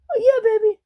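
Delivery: very high, airy wife voice. Acted. Wife cooing to husband in high voice. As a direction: coo it like a giddy wife.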